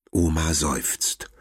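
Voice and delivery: ordinary delivery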